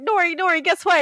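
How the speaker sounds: high, eager calling out; excited news-breaking. Retold or imitated. Running out to tell Nori about getting married, excited. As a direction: call a friend over, pitched right up.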